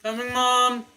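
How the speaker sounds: loud high call to another room; yelling across the room. Real, not acted. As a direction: shout it out to mom downstairs. Calls to mom, lunch ready.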